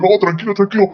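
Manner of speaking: hammy betrayed lover, pleading then wailing; gasping soap opera drama. Retold or imitated. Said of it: "tu actuación de traducción de programa".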